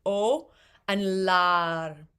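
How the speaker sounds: o and lar with och's ch and larm's M dropped; elided consonants. Shown as a demonstration. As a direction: swallow the ending, o and lar. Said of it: "the end of O C H is basically lost".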